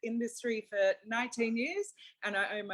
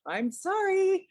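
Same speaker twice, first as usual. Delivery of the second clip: high, loud, slow sing-song sorry; a client's drawn-out mock sorry, pitched way up; mock apology; 10 semitones higher, 7 dB louder, slower than usual. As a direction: ham up the client's cheeky apology. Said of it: "And she's like".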